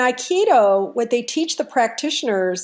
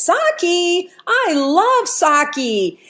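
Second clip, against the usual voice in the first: put-on high, slow, delighted old man; big smile; 7 semitones higher, much slower than usual. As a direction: savour it with a big friendly smile. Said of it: "smiles real big and he says".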